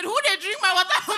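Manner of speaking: half-laughing imitation of the boss complaining about his water; mock boss in pidgin. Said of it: "Oga once said".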